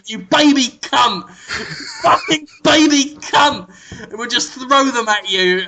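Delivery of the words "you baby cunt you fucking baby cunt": drawn-out, hammed-up abusive shout; mock shouting. Throwing babies at Jonathan, mock shouting. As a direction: half-shout it as a pretend attack.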